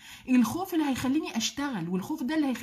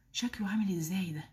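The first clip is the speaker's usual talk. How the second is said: low, hushed half-whisper; soft, low-pitched rhetorical question; hushed wonder; 7 semitones lower, 6 dB quieter than usual. Real, not acted. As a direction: drop to an awed murmur. Hushed wonder at paradise reward.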